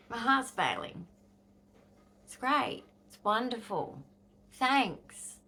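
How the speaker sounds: sarcastic, mock-cheerful thanks to the doctors; bitter sarcasm. Real, not acted. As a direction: drip sarcasm as if grateful.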